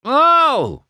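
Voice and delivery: high, loud startled scream; high-pitched yelp of shock. A narrator's reading. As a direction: scream out as icy water hits. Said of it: "he screamed".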